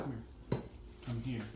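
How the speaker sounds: ordinary talk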